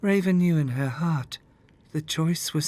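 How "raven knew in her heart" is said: ordinary delivery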